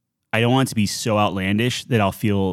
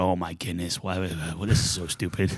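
weary waitress; 3 semitones lower, 7 dB quieter than usual. Retold or imitated. Voicing jaded veteran waitresses.

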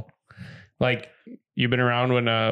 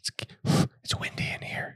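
airy whisper into an ear; playful whisper to annoy her; teasing whisper; 6 dB quieter than usual. Pretend. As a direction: breathe it out like a draft of wind. Blowing into Bree's ear.

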